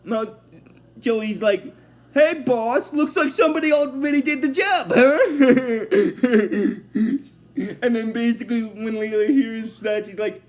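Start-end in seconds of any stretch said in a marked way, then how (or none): 2.1-5.2 higher, teasing henchman quip; jeering henchman. Retold or imitated. Voices a mocking henchman's joke. 5.2-7.2 jeering acted chuckle; mock henchman laugh. Henchman laughs at own joke.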